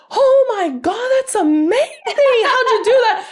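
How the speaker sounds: very high, drawn-out mock amazement; imitated thrilled squeal of a mother; amazed mom. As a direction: gush in a high voice as an astonished mom. Acting out mom's amazed reaction.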